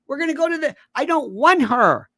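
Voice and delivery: high, loud exasperated retort; irritated refusal. Retold or imitated. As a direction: act out someone flatly refusing, high and insistent. Retelling an angry refusal as quote.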